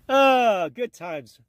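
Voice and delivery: usual speaking voice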